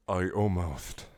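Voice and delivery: ordinary delivery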